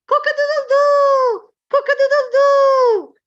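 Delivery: high, loud crowing cock-a-doodle-doo; rooster crow. Shown as a demonstration. Teacher crowing loud for children like a rooster. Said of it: "in English goes".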